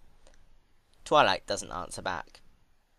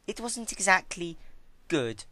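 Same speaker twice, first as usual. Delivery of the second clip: Twilight scolding; 10 semitones higher than usual. Acted. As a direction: sneer at her table manners.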